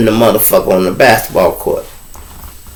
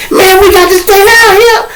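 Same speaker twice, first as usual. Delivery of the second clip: very high, louder complaining voice; whining inmates; 4 dB louder than usual. Retold or imitated. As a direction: whine it like you've been abandoned. Inmates complain about staying.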